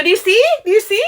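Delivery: high, loud, rising demand; insistent pointing. Retold or imitated. To the manager over the video, high loud insistence. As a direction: make the manager look. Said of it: "I go".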